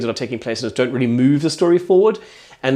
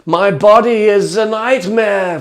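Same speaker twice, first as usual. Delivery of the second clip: slow, loud, high mock lament; hammed-up robot despair; Hugh the depressed robot; 8 semitones higher, 6 dB louder, much slower than usual. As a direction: moan it like a miserable robot, drawn right out. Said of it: "He's quite a depressed robot".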